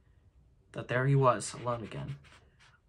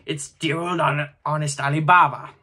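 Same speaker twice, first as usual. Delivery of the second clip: higher, louder, gleeful; delighted; 3 semitones higher, 8 dB louder than usual. A narrator's reading. Scrooge delighting in his old storybook hero, gleeful. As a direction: relish every word of it.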